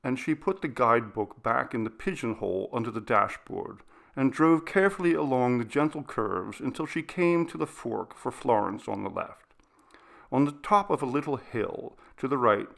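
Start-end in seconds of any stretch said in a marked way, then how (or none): none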